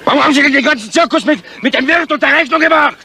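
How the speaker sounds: high, loud exasperated demand; angry outburst. Acted. Aufgebrachte Frage nach dem Zirkus. (empörte, laute Frage, deutlich höher)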